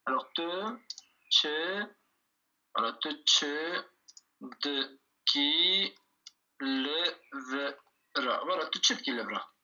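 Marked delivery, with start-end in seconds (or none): 0.3-1.9 "te", then "tche", each separated by a pause; syllable by syllable. Shown as a demonstration. Teacher breaking the question down for learners, "te tche" in separate syllables. 3.0-7.7 slow "te tche de qui lèvera" with pauses between pieces; syllable by syllable. Shown as a demonstration. Teacher writing out the question, "te tche de qui lèvera" syllable by syllable.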